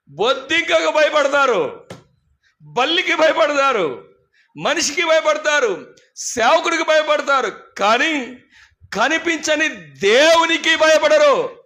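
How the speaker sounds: fiery sermon climax; preaching at full force. Acted. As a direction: hammer each line to the church. Preacher builds a charge.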